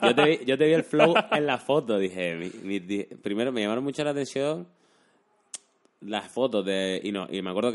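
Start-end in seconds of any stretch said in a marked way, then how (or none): 0.0-2.0 louder, laughing through words; laughing while talking. Real, not acted. Laughing at a shared joke.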